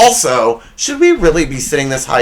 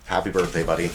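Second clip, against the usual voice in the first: soft, lower heartfelt wish; sincere birthday wish; 8 semitones lower, 9 dB quieter, faster than usual. Real, not acted. Host wishing his best friend happy birthday, soft and sincere. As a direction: say it gently, meaning it. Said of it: "All jokes aside".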